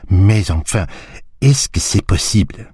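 lightly voiced French detective, surprised; a touch of astonished Belgian; Poirot's French. A narrator's reading. Poirot to the group, mildly astonished in French.

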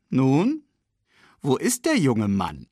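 high, eager questioning lead-in; animated old friend's question; old baboon asking. Acted. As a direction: look round keenly for the newborn. Old baboon looks for the cub.

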